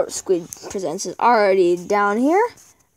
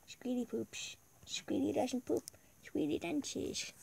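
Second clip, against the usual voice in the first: whispered teasing; 2 semitones higher, 16 dB quieter than usual. Real, not acted.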